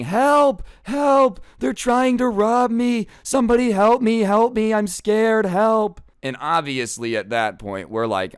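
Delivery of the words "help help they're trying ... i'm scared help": high, loud, hammed-up panicked screaming; a mother screaming that teenagers are robbing her; mock scream for help. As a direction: shriek for help like a fake victim. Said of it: "she starts screaming".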